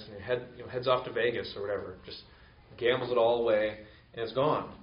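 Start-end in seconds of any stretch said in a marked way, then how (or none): none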